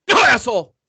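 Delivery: a sudden loud snap of an insult; loud retort. Pretend. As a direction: throw the insult back hard and loud. Acted reply to honey.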